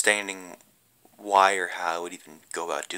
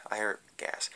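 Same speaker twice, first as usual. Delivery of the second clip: whispered, voiceless words; a quick whispered slip; hushed aside; 9 dB quieter than usual. Retold or imitated. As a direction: drop to a whisper mid-correction. Friend retelling the fire, slipping.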